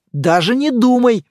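emphatic, no-nonsense warning; firm. A narrator's reading. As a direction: shut the idea down emphatically. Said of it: "твёрдо сказал Добродеев".

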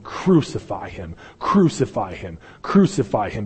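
usual speaking voice

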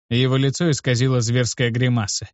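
ordinary delivery

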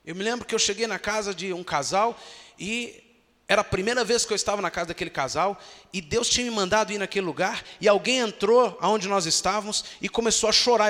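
ordinary delivery

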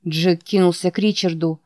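ordinary delivery

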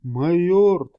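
shocked gasp. A narrator's reading. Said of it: "ахнул Рихтер".

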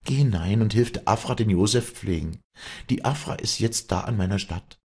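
high-pitched, firm Tyrolean dialect; Walli, resolute farm mistress. Acted. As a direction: give parting orders to the farmhand. Walli gives the farmhand her orders.

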